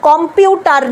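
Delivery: কম্পিউটার as "kom-pyu-tar"; full o and sounded r in কম্পিউটার; Indian pronunciation. Shown as a demonstration. Teacher shows how we say it, Indian pronunciation. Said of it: "আমরা তাই বলি".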